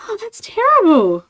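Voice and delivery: loud, high mock-horrified mum; imitated horror. Mum hearing what scammers do, imitated horror. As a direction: wail it up high as an appalled mum.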